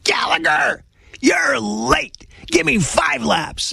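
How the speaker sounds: high-pitched mock coach's shout; screaming coach. Coach calls out a late player across forty yards, mock shouting. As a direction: imitate a coach hollering from afar, stretching the words. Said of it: "he was gonna be screaming at me".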